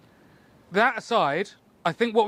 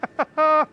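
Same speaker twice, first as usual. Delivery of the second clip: nervous laugh; 9 semitones higher, 6 dB louder than usual. Real, not acted. Laughs at the huge draw weight.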